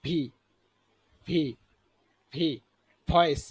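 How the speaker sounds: ভি and ভয়েস with teeth touching lip. Shown as a demonstration. Teacher drilling learners, ভি made with teeth and lip together. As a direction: say v, never b, in ভি and ভয়েস. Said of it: "এটা একসাথে হবে".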